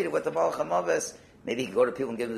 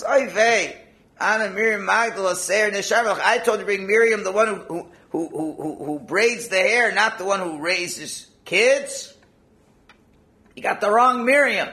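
higher, louder, exasperated boss; exasperated scolding; 6 semitones higher, 5 dB louder than usual. Acted. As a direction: scold the bumbling agent. Angel of death scolds agent.

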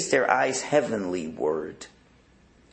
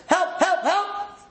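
hammed-up damsel scream; slower than usual. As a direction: ham up the helpless princess screaming. Princess screams during mock kidnapping.

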